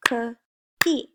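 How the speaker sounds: "Cookie" in two separated beats; each part of "Cookie" on its own; broken into syllables. Shown as a demonstration. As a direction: split "Cookie" after the first syllable. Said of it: "has two parts to it".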